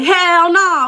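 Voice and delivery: high, loud shout of refusal; outraged shout. Retold or imitated. Shouting no at the Uber driver, outraged. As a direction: yell it, scandalized.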